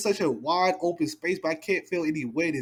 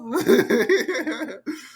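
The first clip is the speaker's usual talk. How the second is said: laughing loudly; 10 semitones higher, 4 dB louder than usual. Real, not acted. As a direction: burst of high loud laughter. Laughs at Luffy running off.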